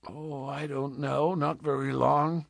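put-on soft, subdued answer to a child; hushed frontiersman, resigned; gently evasive. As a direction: let it out quietly, reluctant. Man gently evades child's question.

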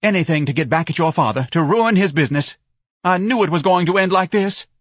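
high, loud, accusing lament; distraught wife. A narrator's reading. Wife berating her husband, high.

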